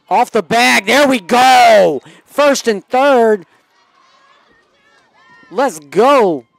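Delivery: loud, high, drawn-out celebration; excited play call. Real, not acted. Calls a good play live.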